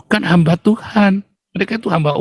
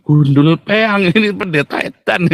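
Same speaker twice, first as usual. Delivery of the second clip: mocking retort broken by laughter; laughing scorn; 3 semitones higher, slower than usual. Retorting about a crazy pastor, laughing scorn. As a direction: laugh through the insult.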